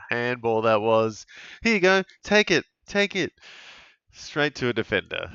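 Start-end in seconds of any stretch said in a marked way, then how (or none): 1.6-3.3 excited urging. Real, not acted. Urging a teammate to take it.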